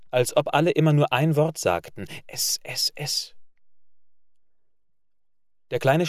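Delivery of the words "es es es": soft breathy murmur of one word; murmured hush. A narrator's reading. Boys murmuring in the hall, softly repeated.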